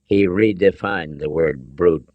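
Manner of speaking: plain reading voice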